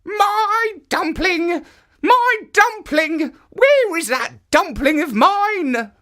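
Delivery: put-on high, slow, wailing old woman's voice; old woman crying out. A narrator's reading.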